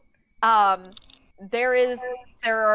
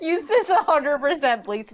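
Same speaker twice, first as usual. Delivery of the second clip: ordinary delivery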